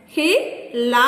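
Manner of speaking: "Hilarious" in four spaced beats, Hi, la, ri, ous; long gaps inside "Hilarious"; syllable by syllable. Shown as a demonstration. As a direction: count out "Hilarious" one syllable at a time. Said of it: "Four syllables".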